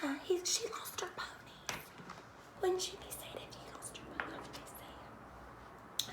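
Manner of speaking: fast, hushed whisper; whispered aside. Real, not acted. Mother whispering to her child about the sad princess, hushed. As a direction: lean in and whisper it.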